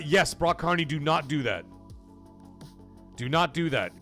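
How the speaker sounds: ordinary delivery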